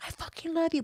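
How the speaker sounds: high, hushed mock declaration; pleading abusive ex. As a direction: imitate his desperate love plea, softly and high. Voicing ex's frantic declaration of love.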